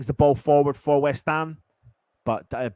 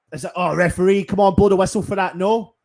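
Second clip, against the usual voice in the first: appeal to ref; 6 semitones higher, 6 dB louder than usual. Real, not acted. Appealing to referee for foul.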